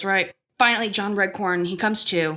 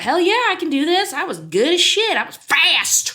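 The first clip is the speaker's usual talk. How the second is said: high, boastful self-hype; cocky excitement; 8 semitones higher, 3 dB louder than usual. Retold or imitated. Recalling confidence before skating, cocky and excited. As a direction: hype yourself up, pitch jumping up.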